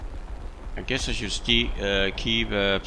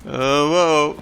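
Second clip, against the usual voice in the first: loud, higher startled "Oh, whoa"; 7 semitones higher, 7 dB louder than usual. Real, not acted. Gamer surprised mid-flight, startled. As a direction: caught off guard. Another wild moment in flight.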